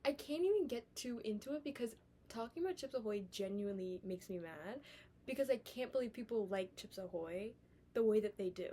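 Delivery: normal talking voice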